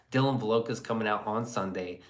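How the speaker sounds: normal talking voice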